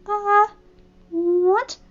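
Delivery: high, startled squeak; flustered surprise. Acted. Fluttershy startled by Applejack's teasing, high flustered surprise. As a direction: caught off guard and flustered. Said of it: "she said".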